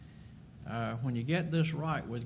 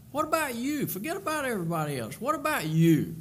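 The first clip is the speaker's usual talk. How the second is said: higher, louder, urging the listener; preaching it home, louder on the last; pressing challenge; 10 semitones higher, 4 dB louder, faster than usual. Real, not acted. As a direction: throw the question at the congregation.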